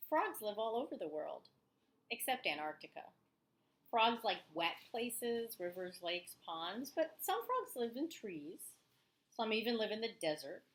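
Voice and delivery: teacher reading a nonfiction book to her class, lively; reading aloud to children. Acted. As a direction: read the book to the kids with energy. Reads the frog book aloud.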